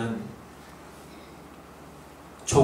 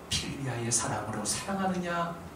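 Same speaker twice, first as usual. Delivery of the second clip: gentle question; 9 dB quieter than usual. Acted. Soft contrast after scolding.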